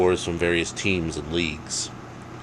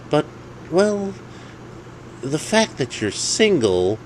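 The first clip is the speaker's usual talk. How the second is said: higher, slow hesitant voice; hesitant friend voice; 10 semitones higher than usual. Acted. Jake delicately pointing out Dave is single. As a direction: ease into the awkward truth. Friend breaking news gently.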